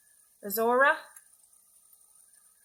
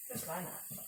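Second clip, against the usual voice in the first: normal talking voice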